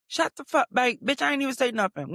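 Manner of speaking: high-pitched retold exasperation; exasperated retort. Patient fed up with technician's small talk, exasperated. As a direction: snap back in mock outrage, pitched high.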